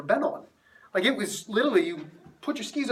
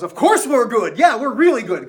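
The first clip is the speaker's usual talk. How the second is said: put-on louder, higher, full of teenage swagger; cocky bragging; 4 semitones higher, 8 dB louder than usual. Cocky teen boasting to the ski instructor about skiing, loud and smug. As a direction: brag like a know-it-all seventeen-year-old.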